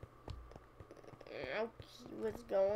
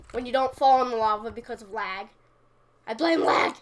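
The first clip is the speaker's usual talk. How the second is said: loud excuse; 14 dB louder than usual. Real, not acted. Gamer loudly blaming lag for falling in lava. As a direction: blame the lag with gusto.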